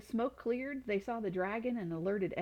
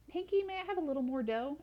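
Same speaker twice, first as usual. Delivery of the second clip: put-on boy voice; 7 semitones higher than usual. A narrator's reading. Boy asks cousin.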